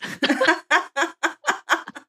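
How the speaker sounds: rising burst of laughter; laughing. Real, not acted. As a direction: let a laugh rise out. Laughing after punchline.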